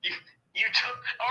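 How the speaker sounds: usual speaking voice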